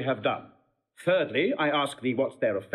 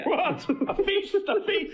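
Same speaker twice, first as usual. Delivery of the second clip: laughing. Acted. Mocking glee at the challenge.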